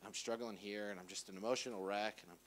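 low, soft, drained confession; acted exhausted murmur; worn-out lament. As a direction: sag under the weight of it. Acts out being worn out.

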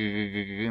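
"g, g, g" said as a soft continuous gargled friction; fricative g demo. Shown as a demonstration. Teacher contrasting the fricative g for learners, soft and rubbing. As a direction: let the g rub on, no stop burst. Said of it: "not like the fricative g".

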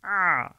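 tight-throated ayn sound; squeezed ayn. Shown as a demonstration. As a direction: squeeze the throat for a thin strained "a". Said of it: "böyle çıkartacağız".

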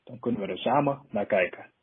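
usual speaking voice

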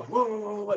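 high, yelping woof-woof noises; imitated dog barking. Storyteller barks like dogs at strangers, playful imitation. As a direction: bark like the town dogs going crazy. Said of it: "they start barking and making tons of noises".

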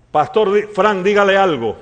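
insistent woman's request, projected; acted urging. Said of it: "y me dijo".

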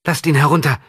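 high, loud commanding cry; shouted demand. A narrator's reading. Maria shouts at the guards, high and loud.

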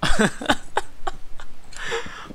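long high-pitched silent wheeze; high wheezing laugh. Real, not acted. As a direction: break up laughing helplessly. Laughing at Welsh comment.

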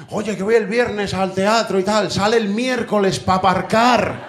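high, drawn-out exasperated call; hammed-up big-city panic, pitched high; stressed city-dweller. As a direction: complain loudly and high, stretching the words. Acts stressed Madrid theatre-goer ranting.

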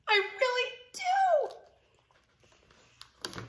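long high plea with a pause; pigeon character, wishful. Acted. Pigeon telling the listeners what it wants, wishful. Pigeon's line in picture book.

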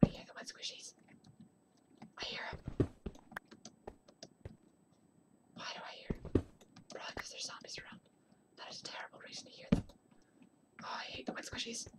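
barely audible whisper; nervous whisper. Real, not acted. Gamer hearing squishy zombie footsteps nearby, hushed whisper. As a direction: whisper as if zombies might hear.

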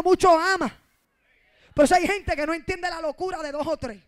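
fervent preacher building up; rising preaching. Acted. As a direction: press the point on the church.

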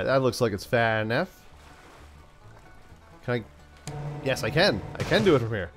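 ordinary delivery

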